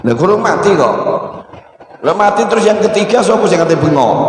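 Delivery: ordinary delivery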